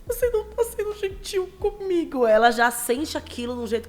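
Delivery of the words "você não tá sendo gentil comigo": imitating a hurt little girl, much higher; high childlike complaint; mock sensitive girl voice; whiny daughter. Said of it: "ela já faz".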